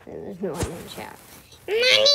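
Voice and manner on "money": high loud happy cry; excited shout. Real, not acted. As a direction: shout with glee at the loot. Finds money in game.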